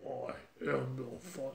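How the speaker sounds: soft, low, bad space villain voice; a weak try at a masked villain; Darth Vader. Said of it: "very bad Star Wars Darth Vader voice".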